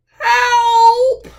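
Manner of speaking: loud, high drawn-out call; cry for help. Acted. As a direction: call out for help as the struggling bear. Bear struggles with heavy load.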